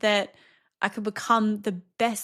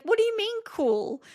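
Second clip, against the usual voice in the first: son's voice; 12 semitones higher than usual. Retold or imitated. Son voiced in retold story.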